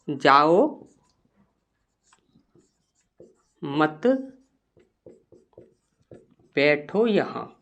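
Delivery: low, soft dictation with long gaps; quiet, measured note-taking voice; slow dictation. Shown as a demonstration. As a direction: dictate word by word, leaving long pauses.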